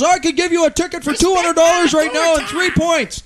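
high, loud mock-cop threat; exaggerated shouting officer impression; threatening cop. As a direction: yell the threat like an irate officer. Retold cop threatens a ticket.

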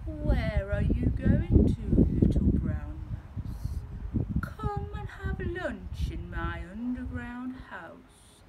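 a slow, lightly voiced sly fox. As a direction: tempt the mouse like a smooth fox. Fox voiced in read story.

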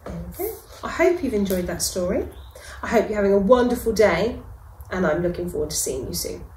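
fast, casual outro; brisk sign-off. Real, not acted. Host wrapping up after the story, quick and casual. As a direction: rattle off the goodbye.

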